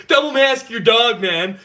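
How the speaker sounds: laughing through the words, slow and loud. Real, not acted. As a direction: crack up mid-joke. Laughing at his own joke.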